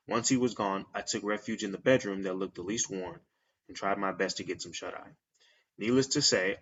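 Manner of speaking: quiet horror story read-aloud; story narration. Acted. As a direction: read it low and steady like a spooky tale. Reads the creepypasta aloud.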